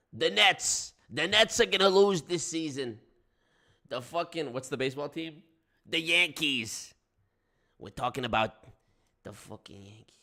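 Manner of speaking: lightly held New York sports grumble; wiseguy complaining about the teams; New York accent. Pretend.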